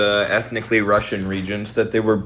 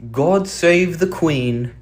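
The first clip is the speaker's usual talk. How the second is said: drawn-out, high mock British accent; put-on British loyal subject; 8 semitones higher, much slower than usual. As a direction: play a reverent British royalist, slowly. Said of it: "people have this attitude".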